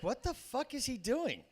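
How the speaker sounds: lightly voiced wife; a hint of a puzzled wife. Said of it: "she's looking at me like".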